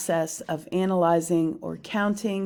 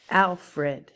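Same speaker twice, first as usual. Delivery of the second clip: Alfred drawn out slowly, AL stressed; stress on first syllable; 2 semitones higher, 4 dB louder than usual. Shown as a demonstration. Teacher showing boom-whoosh in Alfred, stress on AL. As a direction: hit AL, let FRED fall away. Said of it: "which is boom".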